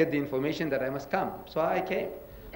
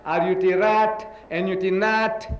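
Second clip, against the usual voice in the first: teacher's spelling drill; slower than usual. Retold or imitated. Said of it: "he says".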